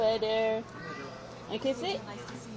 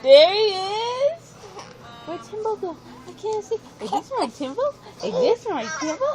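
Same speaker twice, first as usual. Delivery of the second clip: high, playful peekaboo calls; 8 semitones higher than usual. Real, not acted. Peekaboo with toddler again.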